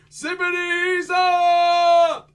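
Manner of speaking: loud, slow magic shout; drawn-out spell shout. Acted. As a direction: stretch the spell out for the crowd.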